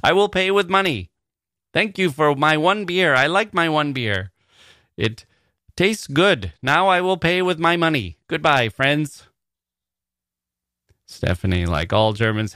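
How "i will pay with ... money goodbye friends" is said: higher, stiff, overcareful tourist voice; clumsy foreigner ordering. Retold or imitated. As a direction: order a beer like a nervous beginner. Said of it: "That's the kind of thing I say".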